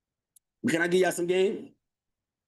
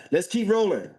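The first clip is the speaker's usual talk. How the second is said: ordinary talk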